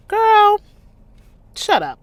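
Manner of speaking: calling the character out; exasperated shout. Real, not acted. Reader scolds character.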